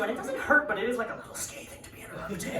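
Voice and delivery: ordinary delivery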